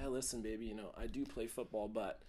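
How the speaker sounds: soft, lowering to a whisper; smooth pickup line. Pretend. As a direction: whisper the secret to a date. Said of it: "be like".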